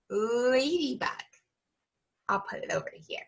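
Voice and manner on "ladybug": "ladybug" with a long, held L at the start; stretched first sound. Shown as a demonstration. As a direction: linger on the L of "ladybug". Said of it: "I hear a ooh".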